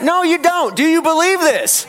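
high, urgent preacher's cry; insistent challenge. Real, not acted. Preacher doubts the church's yes, insistent challenge. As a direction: push the crowd for a stronger yes. Preacher rejects the congregation's answer.

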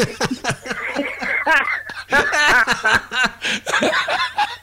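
long, high laugh; hearty laugh. Real, not acted. Laughing after the story.

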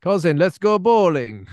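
drawn-out, cartoonish Russian-accented invitation. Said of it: "doing Russian accent".

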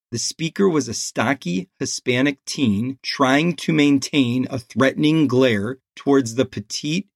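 plain reading voice

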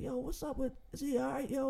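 soft, worried thought; inner worry. Retold or imitated. Thinking about the friend in the back, softly worried. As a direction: think it quietly, scared for him. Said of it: "I'm sitting there thinking".

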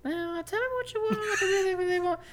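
very high falsetto teasing; squeaky mock voice. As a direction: squeak it up like a cartoon. Repeats same line in squeaky voice.

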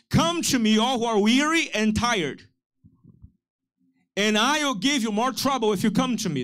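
misquote it to get them to correct you; mock misquote. Shown as a demonstration. Pastor jokingly misquotes Jesus to the church, playful. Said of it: "No, he said".

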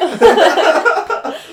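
high, very loud burst of laughter; real big cackling laugh. Real, not acted. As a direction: explode laughing at a joke. Joke about nicknames.

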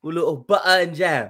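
high, prim polite mock-British voice; proper English kid. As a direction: ham up a dainty English boy asking for toast. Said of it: "Who is this English".

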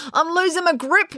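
high, panicky wail; high-pitched cry for help. A narrator's reading. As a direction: wail it as your hands slip. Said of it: "wailed Finn".

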